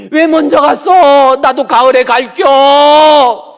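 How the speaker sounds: very loud, high drawn-out cry; imitated grieving wail. Imitating father running back to his late wife, loud grief-stricken wail. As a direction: cry out the lament, stretching every ending. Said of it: "갑자기 그쪽으로 달려가가지고".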